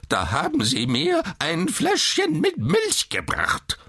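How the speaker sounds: put-on very high, screeching old man's outrage; shrill indignation. A narrator's reading. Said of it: "wurde seine Stimme immer schriller". (schrill vor Empörung)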